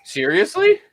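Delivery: high, amazed question; can't believe the rare bottle; surprised. Real, not acted.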